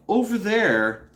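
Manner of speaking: ordinary delivery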